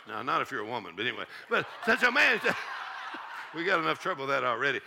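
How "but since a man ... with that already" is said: ordinary talk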